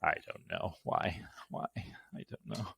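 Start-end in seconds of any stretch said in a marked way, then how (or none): none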